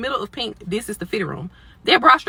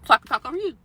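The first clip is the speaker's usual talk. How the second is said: getting loud; 4 semitones higher, 6 dB louder than usual. Shown as a demonstration. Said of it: "make me elevate my voice".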